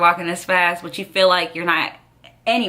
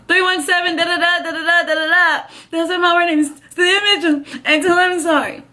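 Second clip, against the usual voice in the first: high, half laughing half crying; laugh-crying plea; 9 semitones higher than usual. Retold or imitated. Hit girl giving paramedics her mom's number, laughing through tears.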